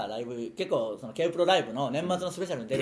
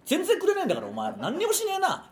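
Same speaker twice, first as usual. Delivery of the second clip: high-pitched griping at freeloaders; comic outraged grumbling; mock indignant rant. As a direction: complain with voice jumping high. Comic rant demanding work from others.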